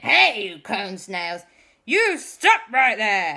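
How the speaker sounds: loud, drawn-out command; shouted challenge. Acted. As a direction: order the villains to halt. Boys challenge the cone snails.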